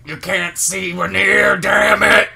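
slow, loud, high angry yell; shaking with anger. Retold or imitated. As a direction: bark it like a furious boss. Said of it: "that he was just like".

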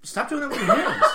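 high, loud laughing protest. Real, not acted. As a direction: laugh while telling her off. Laughing at her gestures.

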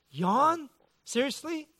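high, incredulous squeak of a question; mock disbelief. Preacher echoing his wife's yawn in mock disbelief. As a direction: sound comically scandalized.

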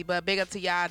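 ordinary talk

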